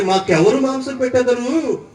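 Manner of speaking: slow, drawn-out recital of a wailed complaint; mock-lamenting scripture reading. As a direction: read it slowly, stretching each craved food as the list climbs. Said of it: "ఏమని ఏడుస్తున్నారంటే". (విలపిస్తున్నట్టు సాగదీస్తూ నెమ్మదిగా చదవడం)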